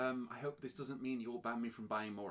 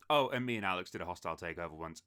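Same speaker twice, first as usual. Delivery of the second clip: ordinary talk, nothing marked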